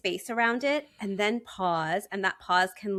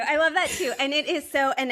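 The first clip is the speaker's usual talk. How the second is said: laughing as you repeat it, delighted; 7 semitones higher than usual. Real, not acted. Host agreeing with her guest, laughing. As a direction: say it again through a real laugh.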